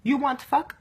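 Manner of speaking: imitating a man's blunt broken-English proposition, Mexican accent. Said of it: "he had really broken English".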